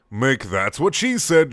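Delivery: slow, punchy punchline read; joke delivery. Acted. Telling the dreaded joke.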